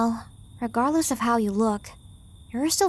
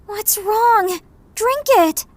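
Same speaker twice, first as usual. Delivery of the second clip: urgent plea; 9 semitones higher, 5 dB louder, much slower than usual. Acted. Urging sick man to drink.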